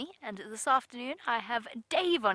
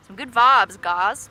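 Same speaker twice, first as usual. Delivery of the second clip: hammed-up South African accent, loud and punched; exaggerated South African accent; 9 dB louder, slower than usual. Shown as a demonstration. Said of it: "That was an exaggerated South African accent".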